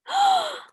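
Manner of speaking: a loud surprised gasp; sharp high gasp. Acted. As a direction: gasp as if startled. Reader gasps at the sight.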